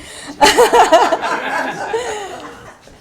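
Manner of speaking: loud high laughing burst, falling off; real burst of laughter. Real, not acted. Teacher laughing at her paid-class joke, loud laugh. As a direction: let a big laugh break out.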